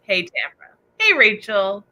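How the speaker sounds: usual speaking voice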